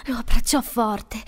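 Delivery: plain reading voice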